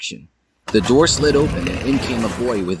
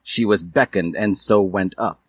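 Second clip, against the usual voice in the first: ordinary delivery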